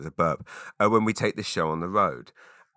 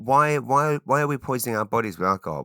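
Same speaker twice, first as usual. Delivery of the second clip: incredulous; 4 semitones higher than usual. Real, not acted. Mock outrage at alcohol.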